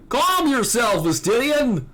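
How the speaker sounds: urgent plea to hold back, lightly voiced; Alberic's new voice. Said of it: "That's Alberic's new voice".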